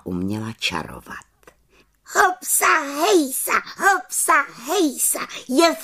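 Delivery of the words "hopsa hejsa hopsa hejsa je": very high, rhythmic cheer; high, exultant chanting; jubilant little witch. A narrator's reading. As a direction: whoop the chant out as a rejoicing witch. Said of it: "jásala malá čarodějnice".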